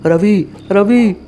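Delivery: high, drawn-out calls of the name, slowed; calling out. Acted. Father calling desperately for his vanished son, high and slow. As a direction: call the lost boy into the empty room. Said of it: "मैं चिल्लाने लगा".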